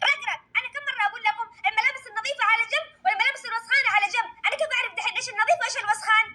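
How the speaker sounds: put-on a fed-up mum scolding the girls; mother complaining nobody listens; exasperated mother. Performer voices the scolding mother.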